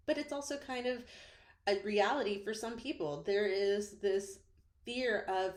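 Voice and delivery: normal talking voice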